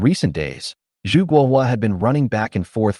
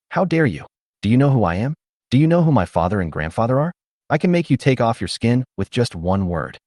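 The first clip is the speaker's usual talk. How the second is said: ordinary delivery, nothing marked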